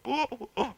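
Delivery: high playful ooh-ooh monkey calls; mock monkey hoots. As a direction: hoot like a monkey jumping on your back. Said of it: "I heard the monkey go".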